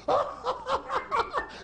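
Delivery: squealing high laugh; high burst of laughter. Real, not acted. Narrator laughs again.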